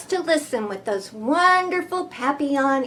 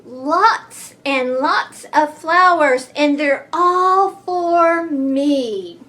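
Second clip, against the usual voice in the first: louder, slow greedy gloat; 2 semitones higher, 5 dB louder than usual. Acted. Greedy bee gloating to himself, loud and slow. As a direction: savour every flower. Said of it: "he buzzed".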